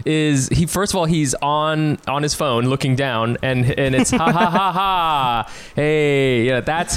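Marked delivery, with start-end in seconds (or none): 4.1-5.5 fake laugh. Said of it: "His fake laugh is".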